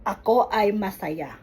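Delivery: "Ako ay masaya" said as one flowing sentence, falling at the end. Shown as a demonstration. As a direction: run "Ako ay masaya" together without pauses.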